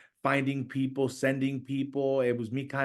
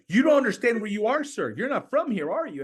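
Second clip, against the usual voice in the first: warning officer; 10 semitones higher, 5 dB louder than usual. Retold or imitated. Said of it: "And they're like".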